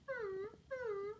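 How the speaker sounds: high, soft whimper of a puppy. A narrator's reading. Lonely puppy in the pet shop, high soft whimpers. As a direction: whine softly like a sad pup. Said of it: "who wouldn't stop whimpering".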